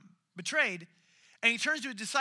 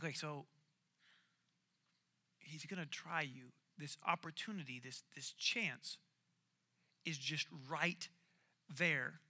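quiet realization; 6 semitones lower, 9 dB quieter than usual. Retold or imitated. Retold realization, musing to himself.

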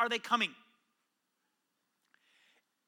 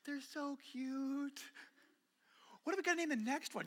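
doting mom; 2 semitones higher, 12 dB quieter than usual. Retold or imitated. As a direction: coo it over the sleeping baby. Mom doting months later.

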